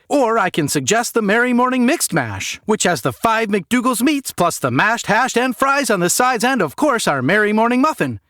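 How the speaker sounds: fast-food cashier greeting a customer, forced cheer; chirpy cashier. Acted. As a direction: sell the muffin menu like a trained robot. Cashier reciting the menu.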